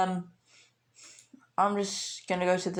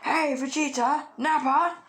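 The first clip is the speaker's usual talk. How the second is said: calling out; 8 semitones higher than usual. Retold or imitated. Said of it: "and he's like".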